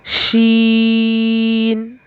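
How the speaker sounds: शीन held long with a drawn sh; slow drawn शीन; letter demo. Shown as a demonstration. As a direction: stretch शीन slowly. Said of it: "देख सकते हैं आप यहाँ पर".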